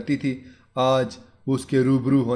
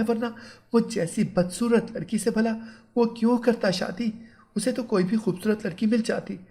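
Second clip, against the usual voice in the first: put-on worried young bride; 8 semitones higher than usual. Kaneez frets alone.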